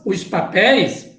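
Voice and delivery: usual speaking voice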